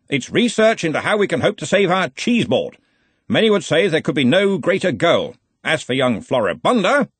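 head wizard laying down the law; blustering Arch-Chancellor. Acted. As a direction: chide your assistant with hearty authority. Ridcully declaims.

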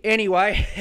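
ordinary talk

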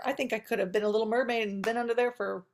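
ordinary delivery

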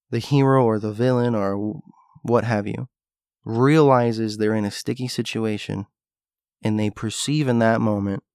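ordinary talk

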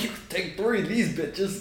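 laughing through the words. Retold or imitated. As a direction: giggle while saying it. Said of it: "I'm like".

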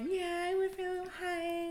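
high, slow mocking voice; imitating the co-host. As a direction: tease with a drawn-out high imitation. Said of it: "you're like".

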